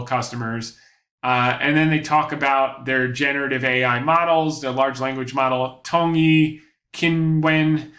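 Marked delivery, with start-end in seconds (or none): 5.8-7.9 careful, non-native Mandarin pronunciation of Tongyi Qinwen; foreigner speaking mandarin. Real, not acted. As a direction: sound out Chinese names like a learner. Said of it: "my Chinese is not, not very good".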